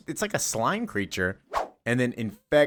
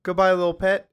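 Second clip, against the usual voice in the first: high goodbye; 9 semitones higher, 4 dB louder than usual. Retold or imitated. Bizarro saying goodbye.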